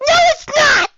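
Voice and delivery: high, loud squeaky shout; squeaking. A narrator's reading. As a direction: squeak out a protest. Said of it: "squeaked Sweet Belle".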